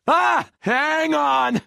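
high, startled cry of warning; alarmed yell. Acted. Pilot to passengers as trouble hits, alarmed yell. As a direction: yell to brace for impact.

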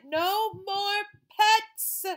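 loud, high, slow yell; exploding mother. Acted. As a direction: blow up at the boy.

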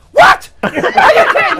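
high, loud disbelieving yell; furious outburst. Retold or imitated. Comic shouts at ex in court, furious outburst. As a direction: explode at the lying ex. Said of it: "I'm like".

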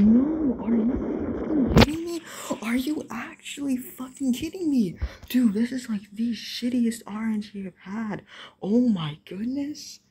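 high, loud outraged yell; outraged disbelief. Real, not acted. Outraged at bad luck.

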